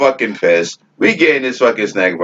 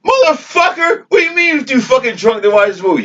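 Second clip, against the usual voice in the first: much higher, shouted retold rage; furious cussing; 12 semitones higher than usual. Yelling at his friend who ruined the movie night, furious shout. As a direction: scream it at your drunk friend, voice cracking. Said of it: "I start cussing".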